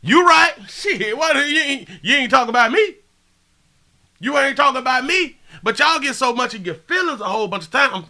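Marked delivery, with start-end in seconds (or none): 0.0-5.4 high, loud mock relief; loud high-pitched relieved denial; mock reaction. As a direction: yell it out like you're off the hook. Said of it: "I'm gonna be like".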